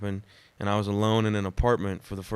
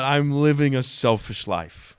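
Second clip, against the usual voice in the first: raised, drawn-out mock cry; half-yell it slowly, higher and louder; retold yell; 4 semitones higher, 5 dB louder, slower than usual. Waking alone from a dream, retold crying out. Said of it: "I yelled out".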